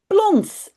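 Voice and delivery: splashy plons. A narrator's reading. Splash sound in story.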